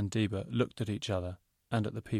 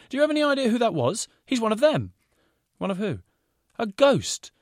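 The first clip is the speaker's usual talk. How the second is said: alarmed warning; 13 semitones higher, 8 dB louder than usual. A narrator's reading. Designer warns the girls urgently.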